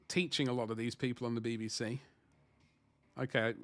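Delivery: ordinary delivery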